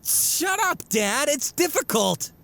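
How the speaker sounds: high flustered yell; embarrassed protest. Acted. Son teased about homework, flustered high protest. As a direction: push back, flustered and caught out.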